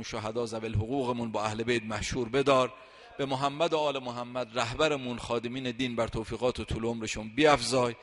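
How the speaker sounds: loud, fervent supplication; closing prayer. Acted. Preacher leading the congregation's closing prayer: loud fervent plea. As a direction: call on God for the whole gathering.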